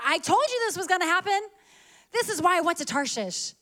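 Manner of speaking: mock Jonah complaining. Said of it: "He tells God".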